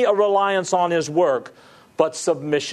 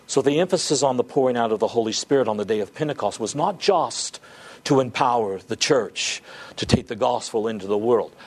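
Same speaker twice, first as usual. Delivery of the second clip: usual speaking voice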